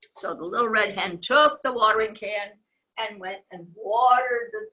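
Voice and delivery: usual speaking voice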